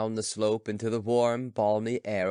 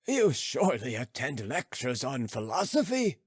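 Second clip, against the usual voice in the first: a hint of amused old sage, higher; amused philosopher; 9 semitones higher than usual. Philosopher teasing the students, lightly voiced. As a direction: tease them gently with questions.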